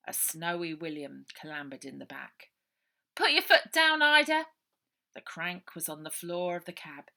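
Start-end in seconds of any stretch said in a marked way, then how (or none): 3.1-4.5 loud high urging call; loud eager command. A narrator's reading. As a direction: push her to drive fast. Urging Ida to drive.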